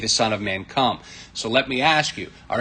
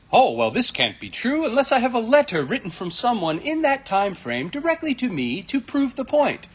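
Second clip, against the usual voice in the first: mocking; 9 semitones higher than usual. Mocking opponent's demand.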